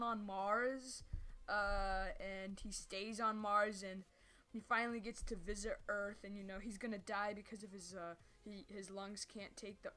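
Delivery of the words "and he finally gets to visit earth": ordinary talk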